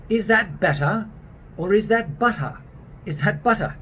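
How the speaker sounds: British accent, questioning. Shown as a demonstration. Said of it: "to practice the British version".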